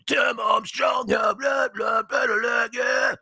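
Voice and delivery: high, drawn-out mock English punk singer; half-sung punk nonsense in a put-on English accent. Host showing co-host the singer's style, high punk rock voice. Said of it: "he's got that kinda punk rock voice".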